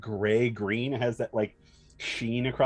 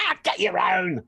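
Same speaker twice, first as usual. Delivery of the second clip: goblin voice; 9 semitones higher, 5 dB louder than usual. Acted. DM voices goblin grabbing food.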